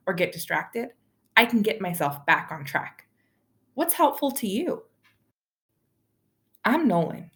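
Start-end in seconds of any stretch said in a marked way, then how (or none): none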